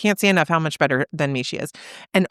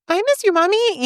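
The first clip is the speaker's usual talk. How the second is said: much higher, sing-song scripted line; high-pitched sing-songy child; 13 semitones higher than usual. Retold or imitated. Mother imitating her echolalic son's scripted voice, high-pitched and sing-songy. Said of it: "high-pitched, sing-songy voice".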